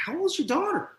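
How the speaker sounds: high-pitched, baffled question; puzzled counselor. Retold or imitated. Counselor questioning the champagne worry, mock-puzzled. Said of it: "he looked at me so strangely".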